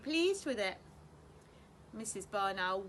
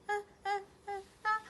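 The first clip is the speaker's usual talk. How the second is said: breathless stammer; 9 semitones higher, 5 dB louder than usual. A narrator's reading. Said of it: "when he'd got his breath back".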